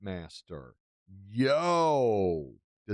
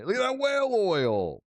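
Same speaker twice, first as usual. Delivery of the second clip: excited; 12 semitones higher, 3 dB louder than usual. Real, not acted. Gleeful at trade prices.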